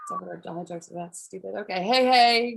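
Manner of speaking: ordinary talk, nothing marked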